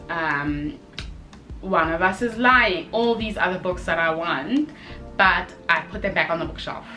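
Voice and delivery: ordinary talk, nothing marked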